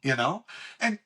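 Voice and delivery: ordinary talk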